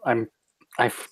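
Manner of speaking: normal talking voice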